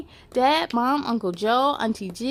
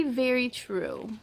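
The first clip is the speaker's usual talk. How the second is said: plain reading voice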